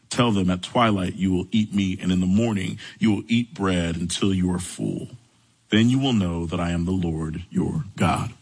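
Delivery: low, measured Bible reading; low-pitched reading from the pulpit; scripture reading. A narrator's reading. Reads scripture aloud.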